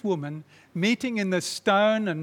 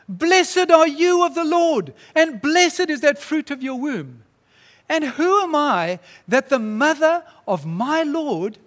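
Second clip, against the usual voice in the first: high, loud, slow blessing proclaimed to Mary; 8 semitones higher, 6 dB louder than usual. Acted. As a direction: proclaim it slowly, with joy. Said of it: "says to Mary in a loud voice".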